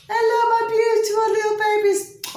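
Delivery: very high, loud squeal to babies; cooing greeting. Real, not acted. Greeting a beautiful little family mid-video, high-pitched cooing.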